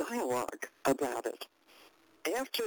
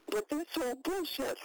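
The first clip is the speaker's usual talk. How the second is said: higher-pitched querulous protest; 10 semitones higher than usual. Retold or imitated. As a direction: imitate your own querulous objection, pitched up. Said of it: "in a kind of a querulous voice".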